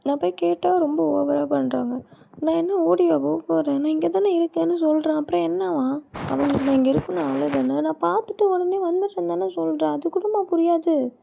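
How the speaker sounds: high-pitched indignant rant; exasperated, protesting; upset complaint. Acted. Ranting complaint about refused permission.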